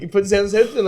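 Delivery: usual speaking voice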